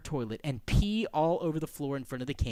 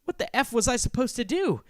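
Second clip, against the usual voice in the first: high, loud exasperated question; 8 semitones higher, 5 dB louder than usual. Acted. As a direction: throw it out in desperation. Narrator at a loss, desperate.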